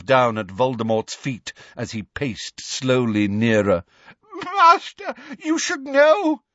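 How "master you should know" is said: very high, tearful plea; sobbing. A narrator's reading. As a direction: wail to the master. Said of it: "sobbed Bellatrix".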